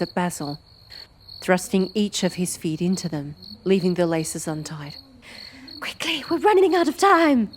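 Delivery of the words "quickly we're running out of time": urgent. Acted. Urgent hurry.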